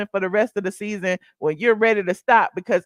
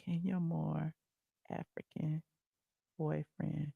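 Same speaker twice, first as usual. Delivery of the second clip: hushed whisper, much softer; 6 semitones lower, 17 dB quieter, slower than usual. Real, not acted. Host whispering gossip to her viewers.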